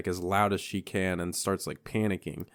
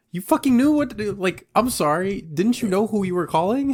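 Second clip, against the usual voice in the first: much higher, louder and fast, fed-up rant; exasperated outburst; 10 semitones higher, 4 dB louder than usual. Retold or imitated. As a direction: hurl it at him, high and rushed. Exasperated at Nash, quoting herself.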